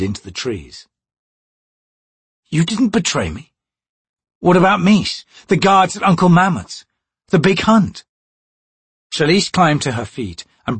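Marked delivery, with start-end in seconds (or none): 2.5-8.0 put-on confused young thief. Crocus confused.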